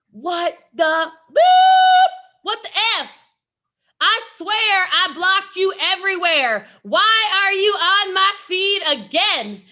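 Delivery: loud, high, drawn-out angry rant; all-caps outrage. Retold or imitated. Annoyed girl yelling at Jesse Lee in her feed, loud high outrage. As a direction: blast it like an all-caps comment. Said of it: "she commented in all caps".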